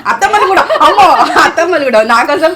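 high-pitched, laughing hurry to agree; laughing protest. Real, not acted. Daughter-in-law hastily adds her mother-in-law to the praise, laughing protest.

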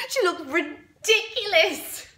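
high, squeaky with laughter; gleeful laughing. Acted. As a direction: gloat through helpless giggles. Gloating laughter at the girl.

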